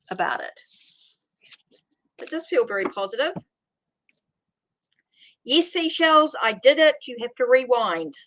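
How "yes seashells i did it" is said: excited. Real, not acted. Pleased she got it right.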